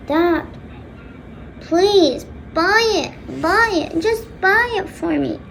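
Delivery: high, drawn-out whiny begging; acted pestering daughter; pleading.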